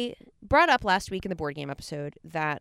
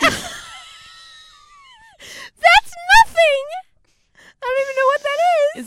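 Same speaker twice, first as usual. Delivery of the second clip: high, loud laughing outburst; laughing shout; 9 dB louder, slower than usual. Real, not acted. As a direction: shriek through tears of laughter. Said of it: "You're crying".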